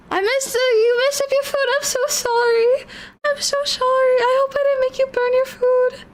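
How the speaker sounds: high, tearful apology, voice breaking; sobbing sorry as it spills out; crying apology. Real, not acted. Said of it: "I'm a little bit of a crybaby".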